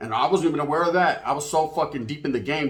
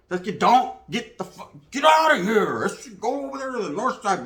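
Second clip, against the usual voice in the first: mock yell; 6 semitones higher than usual. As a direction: bark them off the block. Gang chasing him off.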